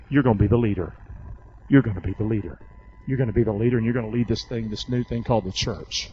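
low, calm assurance; a hint of a tender Jesus; lightly voiced Jesus. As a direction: tell him gently he will lead. Jesus voiced telling Peter.

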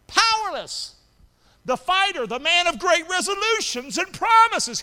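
loud, high revival preaching; preacher building to a shout; full-force preaching. Acted. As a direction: drive the story home to the congregation. Preaches the Peter story.

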